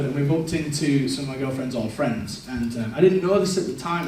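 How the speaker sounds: ordinary talk, nothing marked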